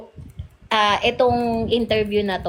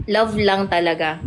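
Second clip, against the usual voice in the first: ordinary delivery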